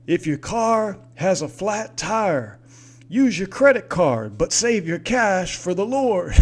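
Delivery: high, loud hammed-up preacher voice; mocking radio preacher. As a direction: ham it up like a greedy radio preacher. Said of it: "and he's like".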